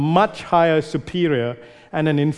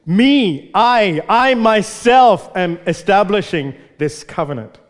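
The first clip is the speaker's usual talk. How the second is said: higher and louder, leaning hard on "Me" and "I"; emphatic God voice; 4 semitones higher, 4 dB louder than usual. Acted. Preacher voicing God's promise to Noah, loud and emphatic. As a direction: proclaim it as God staking His own name. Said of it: "it's almost like this. He's saying this".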